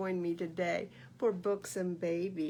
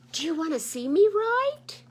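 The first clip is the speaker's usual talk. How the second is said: nosy goose; 7 dB louder than usual. Acted. Goose bothering thinking bear, loud eager nagging. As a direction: keep poking him with loud questions.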